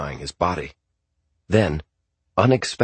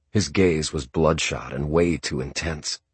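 ordinary delivery, nothing marked

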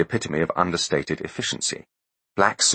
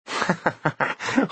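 high chittering laugh; crickety laugh; 13 semitones higher than usual. Acted. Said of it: "Spiro laughed. It sounded like a box of crickets".

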